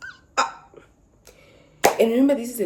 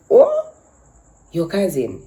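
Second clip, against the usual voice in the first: ordinary talk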